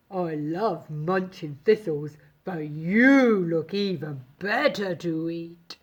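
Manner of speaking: low bleating goat voice. A narrator's reading. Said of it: "bleated the goat".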